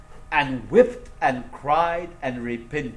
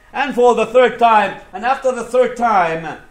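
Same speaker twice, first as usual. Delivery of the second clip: raised, emphatic sermon voice; impassioned preaching; 7 semitones higher, 11 dB louder than usual. Acted. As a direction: build to the climax of the story. Preaches the third denial.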